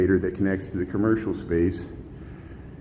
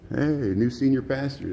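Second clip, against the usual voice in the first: ordinary delivery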